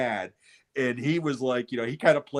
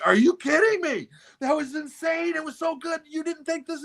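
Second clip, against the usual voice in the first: much higher, half-mock teen outrage; incredulous disbelief. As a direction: squeak out the disbelief like a stunned fifteen-year-old. Son incredulous at dad.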